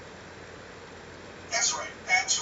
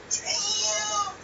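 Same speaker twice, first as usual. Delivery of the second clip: high, drawn-out amazed exclamation; gleefully scandalized, pitched way up; stunned reaction; 12 semitones higher, much slower than usual. Retold or imitated. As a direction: replay your shock in a high, stretched voice. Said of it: "I was like".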